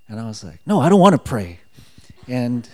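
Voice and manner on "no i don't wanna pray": louder, higher retold refusal; loud mock objection; acted protest. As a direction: push back like a balky child. Acting out refusal to inner voice.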